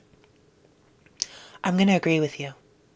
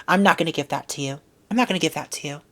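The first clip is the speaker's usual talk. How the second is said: normal talking voice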